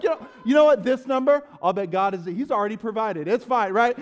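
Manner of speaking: high, loud forced reassurance; retold scramble to sound pastorly, still worked up; frantic pastor backpedal. Switching to [name] after being recognized, frantic reassurance. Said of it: "from that guy to [name]".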